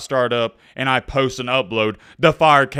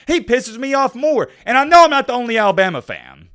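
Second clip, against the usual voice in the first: worked up, higher-pitched fan rant; hyped-up and really irritated, pitch jumping high; passionate; 9 semitones higher, 6 dB louder than usual. Real, not acted. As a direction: vent like a fed-up, passionate fan. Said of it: "most passionate and hyped up".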